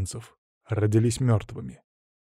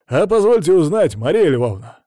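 loud, high formal inquiry; mock-serious driver; 5 semitones higher, 6 dB louder than usual. A narrator's reading. Driver formally questions his passenger, serious tone. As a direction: ask it with showy seriousness. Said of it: "спросил Рэй серьёзным тоном".

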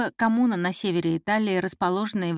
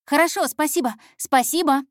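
higher, louder, flustered gratitude; eager thanks; 7 semitones higher, 5 dB louder than usual. Acted. Intern thanking the boss for a small favour, eager. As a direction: thank him quickly and eagerly, twice over.

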